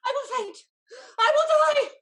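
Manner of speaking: high, loud half-laughing wail; hysterical panic. Acted. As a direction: wail it like she'll swoon. Lady swooning in distress.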